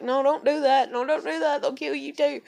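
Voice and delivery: loud, high mimicked protest; panicked pleading. Boyfriend begging girlfriend to stay away, panicked.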